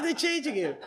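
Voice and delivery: high, excited imitation; hammed-up astonished cry; amazed onlooker. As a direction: exclaim it in mock disbelief. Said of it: "running up and he goes".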